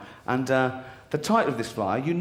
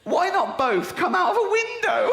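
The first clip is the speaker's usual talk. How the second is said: incredulous laughing; 10 semitones higher than usual. Real, not acted. Reading the final item, incredulous.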